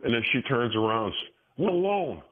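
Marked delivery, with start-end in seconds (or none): 1.6-2.2 high, mocking imitation of her; mock woman's retort. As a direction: throw her words back in a high voice.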